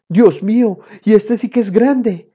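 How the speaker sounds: high, loud amazed dad; astonished exclamation. A narrator's reading. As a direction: exclaim in amazement at what you see. Father astonished at the big baby.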